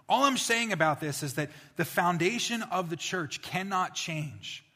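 normal talking voice

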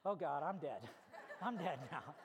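low, resigned dread played for laughs; hammed-up "I'm done for" aside; comic dread. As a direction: sink low, mock-doomed. Teen caught by scary neighbor.

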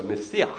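"Messiah" as ma-see-ACH; Hebrew pronunciation. Shown as a demonstration. Pastor sums up Jesus' title, "Messiah" with throaty Hebrew ending. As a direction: finish "Messiah" with a guttural ch from the back of the throat. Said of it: "in the back of the throat".

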